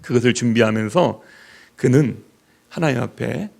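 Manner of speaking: usual speaking voice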